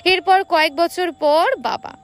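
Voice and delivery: plain reading voice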